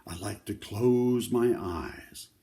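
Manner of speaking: usual speaking voice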